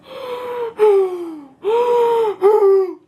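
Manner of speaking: high, loud, heaving gasps; hyperventilating. Real, not acted. Reader overcome by cringe, hyperventilating. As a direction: gasp over and over in overwhelmed cringe. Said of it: "I'm hyperventilating".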